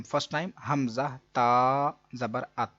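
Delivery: slow, drawn-out spelling of अत, ता joined to the हमज़ा before it; joining the letters slowly. Shown as a demonstration. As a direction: spell out हमज़ा ता ज़बर and land on अत. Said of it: "मिलाएंगे हम". (अत को धीरे-धीरे मिलाकर पढ़ना)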